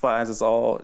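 usual speaking voice

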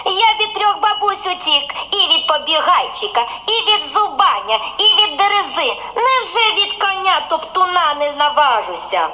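laughing bun. A narrator's reading. Bun boasts again laughing.

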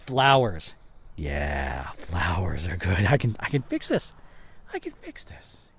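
ordinary talk

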